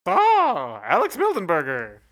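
high, loud cartoon bird voice. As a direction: squawk your name like a cartoon bird. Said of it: "cartoon voice or just make some, like, mumbling noises".

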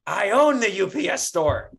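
a smug, put-out "Buddy" reveal; irritated store owner. Retold or imitated. The store owner correcting him over the phone, mock irritated. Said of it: "And he goes".

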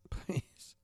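breathy laughing whisper of please; a whispered, half-laughing "please" to the listeners. Real, not acted. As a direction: let "please" slip out as a chuckled whisper. Begging listeners, then whispered again.

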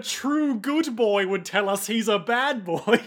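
high, gushing fondness; doting reassurance. Retold or imitated. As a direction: coo it with hammed-up sentiment.

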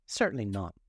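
ordinary delivery, nothing marked

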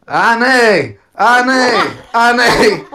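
loud, high mock cries; imitated sex cries. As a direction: mimic passionate calls, high and loud. Imitating partner's cries during sex.

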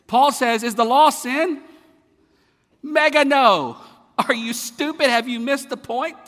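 loud, high preaching shout; full-force sermon climax; impassioned preaching. Acted. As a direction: thunder it from the pulpit.

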